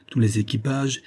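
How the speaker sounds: ordinary delivery, nothing marked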